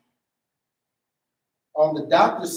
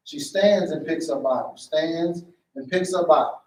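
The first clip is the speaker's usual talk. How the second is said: ordinary delivery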